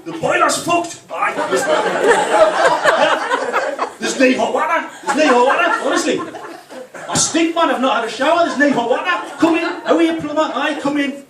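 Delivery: high, very loud Geordie shouting; broad Geordie accent. Acted. Man greeting the plumber at the door, hammed-up loud Geordie. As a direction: bellow it in exaggerated, matey Geordie. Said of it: "my Geordie accent comes back".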